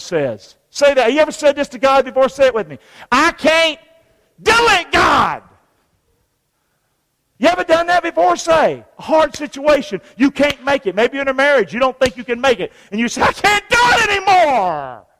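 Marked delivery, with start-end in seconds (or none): 3.1-5.4 high, slow mock wail; acted despair. Moses telling God he can't, high drawn-out wail. 13.2-15.1 high, drawn-out mock wail; acted despair. Someone in a hard marriage giving up, high wail.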